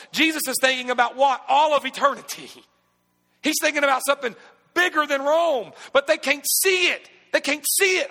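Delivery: high, loud, rising preacher's cry; preaching at full force. Acted. Pastor preaching to his congregation, full force. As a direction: build it up and preach it hard.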